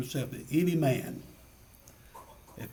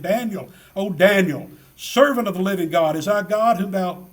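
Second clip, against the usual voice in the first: louder crying out in lament; 4 semitones higher, 7 dB louder than usual. Retold or imitated. The king calls down into the den for Daniel, loud lamenting cry. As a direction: call out to Daniel in a lamenting wail. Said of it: "in a lamenting voice".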